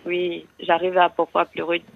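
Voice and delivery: ordinary talk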